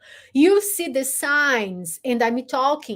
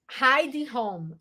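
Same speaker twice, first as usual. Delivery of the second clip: usual speaking voice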